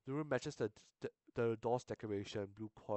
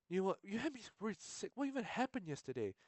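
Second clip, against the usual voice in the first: much higher put-on girl's voice; worried girl voice; 10 semitones higher than usual. Tiffany fussing over Niwa after his scare, high worried voice. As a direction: pitch way up into a fretting girl.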